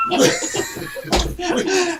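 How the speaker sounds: very high drawn-out call. Real, not acted. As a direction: call it out high over the host. Calling out over the sign-off.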